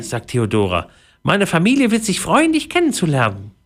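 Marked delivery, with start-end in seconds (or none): 1.2-3.5 very high, eager mouse voice; squeaky little voice. A narrator's reading. Mouse inviting her new friend home, high squeaky voice. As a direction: pipe up high like a tiny mouse. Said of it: "ein piepsendes Stimmchen".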